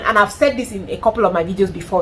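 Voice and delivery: ordinary talk, nothing marked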